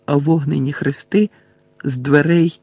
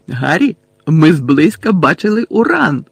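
silly excited voice, stretched and louder; giggly, dazed Ron; 2 semitones higher, 5 dB louder than usual. A narrator's reading. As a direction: share it like a giddy, addled boy. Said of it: "знову кволо гигикнув Рон".

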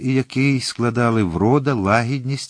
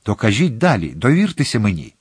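plain reading voice

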